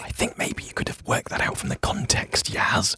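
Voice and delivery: hushed reply; whispering. Acted. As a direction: whisper back under your breath.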